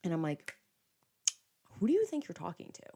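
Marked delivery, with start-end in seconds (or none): 1.6-2.9 higher-pitched, contained indignation; retold pissed-off challenge. Woman whipping round at the rude friend, indignant challenge. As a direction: challenge him without raising the volume. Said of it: "now I'm pissed off".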